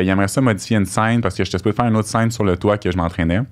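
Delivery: normal talking voice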